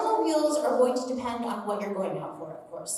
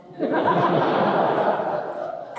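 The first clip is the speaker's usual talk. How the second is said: laugh; 6 semitones higher, 7 dB louder than usual. Real, not acted. Laughs at the story.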